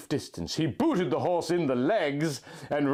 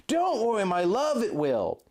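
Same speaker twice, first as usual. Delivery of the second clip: Link, tender guide; 8 semitones higher than usual. A narrator's reading. Link guiding his love through the blizzard, high and gentle. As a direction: lead her by voice through the snow.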